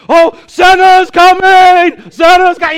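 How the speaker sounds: shrieking high and loud with joy; Elf-style squeal. Retold or imitated. Elf shouting that Santa is coming, shrill glee. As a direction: squeal like a kid at Christmas. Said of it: "and he's like".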